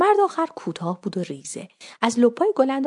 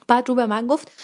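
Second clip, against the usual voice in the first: plain reading voice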